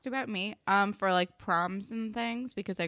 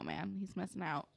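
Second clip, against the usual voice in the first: ordinary talk, nothing marked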